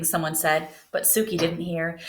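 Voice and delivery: ordinary delivery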